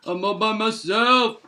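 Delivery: same words back near own pitch, lightly voiced; understated second take. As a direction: drop back down, a hint of the bear. Lonely bear, said twice.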